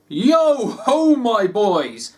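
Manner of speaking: hearty, high, loud greeting; a beaming old master rallying the boys; jovial call. A narrator's reading. Fezziwig greets his apprentices with a hearty jovial call. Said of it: "said Fezziwig".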